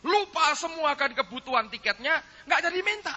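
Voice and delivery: loud, high-pitched retelling; impassioned preaching. Retold or imitated. Preacher retelling wife's tearful prayer, impassioned and loud.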